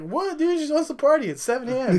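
high-pitched, incredulous comeback; mock indignant protest. Acted protest back at screaming mom.

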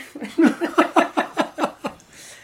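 loud, high real laugh; laughing at the bank answering; burst of laughter. Real, not acted. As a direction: break out laughing at the mix-up. Laughing at the wrong number.

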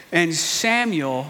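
normal talking voice

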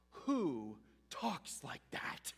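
very slow hushed whisper. Real, not acted. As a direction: drop to a breath, let it hang. Preacher repeats the rhetorical question.

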